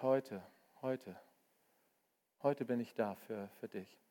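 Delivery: soft, low, gentle; hushed loving reassurance; tender promise. Acted. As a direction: promise it quietly to one person. Jesus quoted, tender promise.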